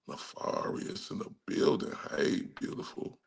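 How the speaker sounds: hushed aside to viewers; whispered shout-out. Real, not acted. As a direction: whisper the greeting, barely voiced. Shout-outs to chat viewers.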